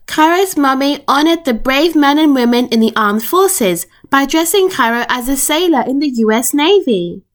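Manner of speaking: ordinary delivery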